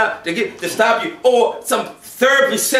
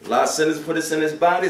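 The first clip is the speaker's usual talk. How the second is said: ordinary talk, nothing marked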